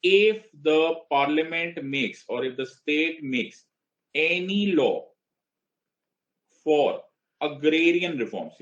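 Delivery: very slow, high, drawn-out recitation; declaimed reading. Acted. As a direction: declaim it phrase by phrase, with pauses. Declaims the article line by line. (खींच-खींचकर, ऊँचे सुर में पाठ)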